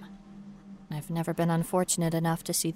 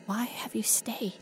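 whisper; 3 semitones higher, 5 dB quieter than usual. Acted. Whispered question.

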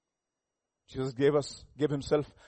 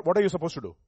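ordinary delivery

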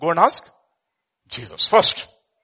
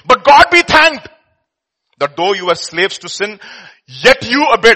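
loud, slow, higher proclamation; proclaiming scripture; 5 semitones higher, 9 dB louder, slower than usual. Real, not acted. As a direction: declare it with joy.

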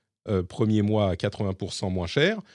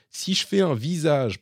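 normal talking voice

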